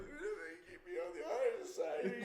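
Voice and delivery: high, drawn-out whimpering wail; mock crying. Suspended boy worried about honor society, mock tearful wail. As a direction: plead through exaggerated blubbering. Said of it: "just inaudible fucking me crying".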